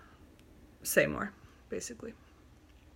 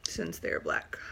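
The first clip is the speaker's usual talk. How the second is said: whispered; 13 semitones lower, 7 dB quieter than usual. Real, not acted. Hushed on a sensitive word.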